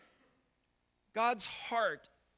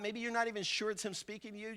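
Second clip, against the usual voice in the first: ordinary talk, nothing marked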